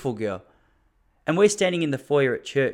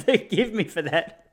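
laughing apology; 7 semitones higher, slower than usual. Real, not acted. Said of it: "the intensity I'm coming at you with".

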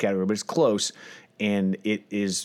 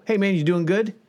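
higher, bright leading greeting tone; leading question, upbeat; 10 semitones higher than usual. Retold or imitated. Mimicking people who steer the greeting, upbeat. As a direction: push them toward the answer you want. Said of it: "they'll go".

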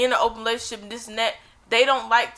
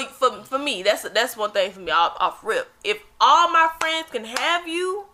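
normal talking voice

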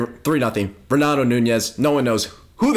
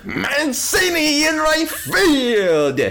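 high, slow honking goose call; 12 semitones higher, much slower than usual. Retold or imitated. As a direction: honk it out like a low-flying goose. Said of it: "he called it his low-flying goose voice".

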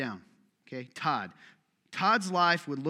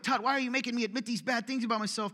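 complaining congregant; 7 semitones higher, faster than usual. Retold or imitated. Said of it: "sometimes people are like".

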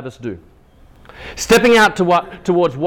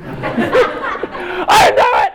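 put-on high, drawn-out little-boy yell; little child's voice; 10 semitones higher, 5 dB louder, much slower than usual. Son riding at last shouts to dad, little-voice yell. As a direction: imitate a small child shouting in triumph, very slowly. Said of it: "you hear that little voice".

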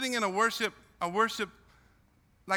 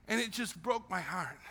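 normal talking voice

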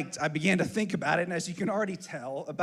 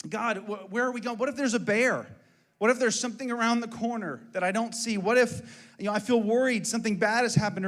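anxious pleading; 6 semitones higher than usual. Acted. Voices anxious questions to God.

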